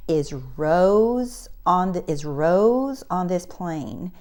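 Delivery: slow, drawn-out announcement voice; paging gate agent. Retold or imitated. Gate agent calls for Rose on the plane, slow paging. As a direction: page a passenger, stretching out the name. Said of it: "comes on the plane and pages me".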